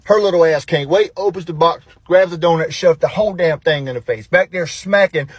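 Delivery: ordinary delivery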